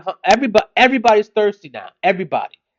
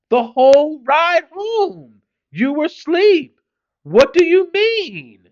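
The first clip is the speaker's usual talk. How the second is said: much higher, slow disbelief; incredulous; 9 semitones higher, 3 dB louder, slower than usual. Real, not acted. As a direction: stretch it out in total disbelief. Dad disbelieving child's denial.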